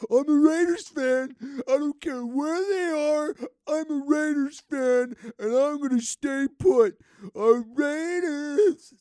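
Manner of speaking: high, loud, wailing mock sob; mock crying fan. Mocking Raiders fans who won't quit, loud wailing mock sob. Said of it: "Don't cry".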